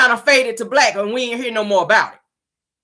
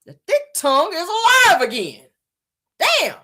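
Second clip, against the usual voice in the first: mock dismay; 9 semitones higher than usual. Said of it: "I said".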